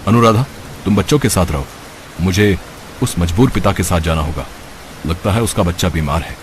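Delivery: a composed doctor deciding to help; calm doctor. Acted. Doctor figure speaks. (शांत, गहरी doctor की आवाज़)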